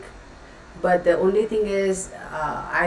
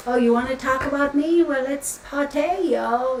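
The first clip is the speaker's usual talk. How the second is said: higher-pitched mock party boy; imitating party-boy energy; 7 semitones higher than usual. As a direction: play a cocky party guy hyping himself up. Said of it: "his energy is like".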